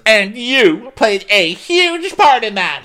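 high, drawn-out accusing retelling; mock accusation. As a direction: act out Candace's accusation, slowly and high. Acted accusation from a quarrel.

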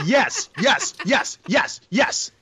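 high, eager repeated yes; excited cheering. Real, not acted. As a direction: cheer him on gleefully. Cheering a perfect bad pour.

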